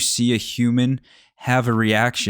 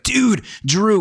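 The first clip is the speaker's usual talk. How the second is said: mock drunk friend, high and excited; 11 semitones higher, 3 dB louder than usual. Drunk friend hyping his buddy, high excited voice. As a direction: hype up your buddy, tipsy. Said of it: "but he was like".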